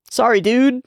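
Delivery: high sheepish apology. Retold or imitated. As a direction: squeak out a guilty sorry. Voicing Irie apologizing.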